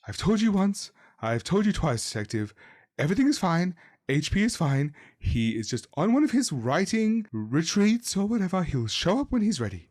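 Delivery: tired of repeating herself, mildly annoyed; agitated mother. A narrator's reading. Game master as the mother refusing the detective, mildly agitated. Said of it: "clearly agitated".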